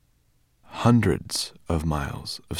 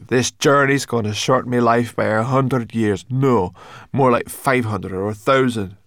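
raised, louder complaining voice; grumbling Sorrel; 4 semitones higher, 6 dB louder than usual. A narrator's reading. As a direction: moan it out grumpily, pitched up.

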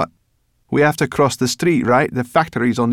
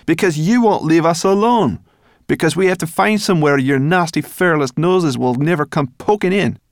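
much higher and louder, building anger; furious little creature tirade; indignant brownie rant; 10 semitones higher, 7 dB louder than usual. Acted. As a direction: scold him harder and harder. Sorrel rants at the human boy.

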